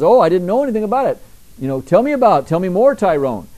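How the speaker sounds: high, fast feigned innocence; mock innocent Herod. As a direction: play dumb like sly Herod.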